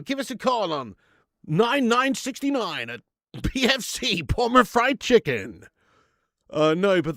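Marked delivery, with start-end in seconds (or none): 0.0-5.6 slow, higher, announcer-style ad read; lightly hammed-up commercial; mock advert voice. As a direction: sell it like a cheesy radio ad.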